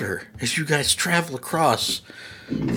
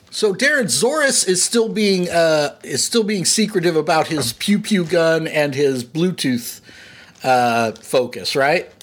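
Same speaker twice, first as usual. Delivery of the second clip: ordinary delivery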